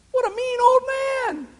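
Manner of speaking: exaggerated mock dismay at the mean prophet; mock outrage. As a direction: gasp it out in pretend shock.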